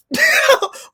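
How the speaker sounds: sudden, loud, squealing laugh; real laugh breaking out; high burst of laughter. Real, not acted. Scene: host bursting into laughter at the quoted line, loud squeal.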